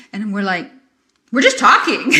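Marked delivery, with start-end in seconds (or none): 1.3-2.1 loud, higher protest with a laugh; half-laughing mock yell; retold yelling. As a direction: playfully raise your voice in mock defense. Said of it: "we're yelling".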